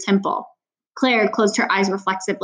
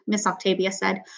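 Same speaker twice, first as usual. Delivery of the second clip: plain reading voice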